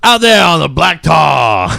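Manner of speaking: loud, slow, high Southern drawl; southern accent. Acted. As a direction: draw it out in a hammed-up Southern accent. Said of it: "Got real southern in there".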